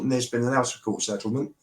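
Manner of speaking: ordinary talk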